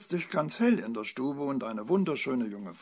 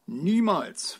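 loud, high outright refusal; firm refusal; 8 semitones higher, 10 dB louder than usual. A narrator's reading. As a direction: fend it off emphatically. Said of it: "wehrte der Bauer ab".

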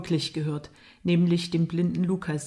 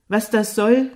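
louder, indignant echo question; angry retort; 3 semitones higher, 6 dB louder than usual. A narrator's reading. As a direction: throw the question back angrily. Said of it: "kam die wütende Antwort". (wütende Gegenfrage)

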